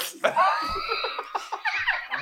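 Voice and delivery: squealing burst of laughter; shrieking high laugh. Real, not acted. Cracking up over the slug.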